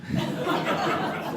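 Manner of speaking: airy real laugh; breathy laugh. Real, not acted. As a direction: laugh at the memory. Laughing at hot tub story.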